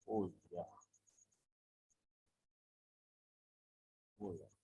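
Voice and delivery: low, soft "ඕ යා" falling away; descending recollection. Shown as a demonstration. Teacher showing students remembered knowledge, low descending "ඕ යා". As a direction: let "ඕ යා" drop quietly as if just remembering. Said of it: "මට මතකයි කියන අදහසක් පෙන්නන්න".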